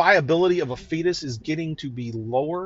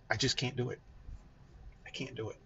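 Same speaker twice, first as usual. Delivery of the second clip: quiet, defeated voice fading away; choked up, trailing off; 2 semitones lower, 13 dB quieter, faster than usual. Real, not acted. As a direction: let it drop to almost nothing as it fades. Overwhelmed father breaks off.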